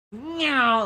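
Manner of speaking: high, loud "neow" whoosh; loud zooming car noise; imitated car zoom. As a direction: mimic a car zooming past, pitch falling away.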